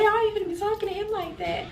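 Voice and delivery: high-pitched retold protest; indignant defending. Retold defending partner against mother.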